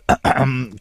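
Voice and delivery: loud, pointed ahem; loud throat clearing. A narrator's reading. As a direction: clear your throat to get attention. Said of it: "cleared his throat very loudly".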